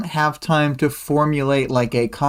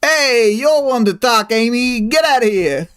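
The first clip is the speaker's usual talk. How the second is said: loud, high, hammed-up New York accent; New Yorker accent; 9 semitones higher, 3 dB louder than usual. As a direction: heckle in a mock New Yorker accent, raised voice. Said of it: "wish Gru had a New Yorker accent".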